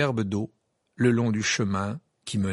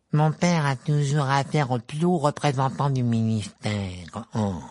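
mocking Malfoy; 2 semitones higher than usual. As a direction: parrot the boast to ridicule it. Ron mimics Malfoy.

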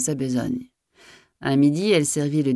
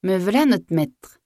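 obedient maid answering; 5 semitones higher, slower than usual. A narrator's reading. Maid answers master's call.

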